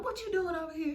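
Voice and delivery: high, nosy-sounding question; imitated high-voiced questioning; mock officer query. Voicing officer to van driver.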